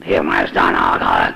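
deep rough low growl; truck voice. Retold or imitated. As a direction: rumble it like a truck. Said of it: "He had a voice like a truck".

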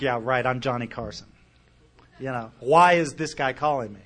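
retold smartass reaction, pitched a bit low. Speaker doubting the stranger on the phone, mildly smartass. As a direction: toss it off like a wisecracking skeptic. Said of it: "my first reaction was to be a smartass".

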